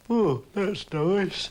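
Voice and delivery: slow, sleepy murmur; half asleep, drawl it; drowsy, slow Samson; drowsily. Acted. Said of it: "said Samson drowsily".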